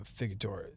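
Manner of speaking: a failed, cut-off try at "figator-"; stumbling over word. Real, not acted. Podcaster can't get the word out, stumbling on "figator-". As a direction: trip over "figator-" and break off. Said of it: "I can't even say it".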